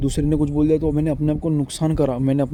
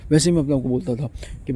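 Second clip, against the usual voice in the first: usual speaking voice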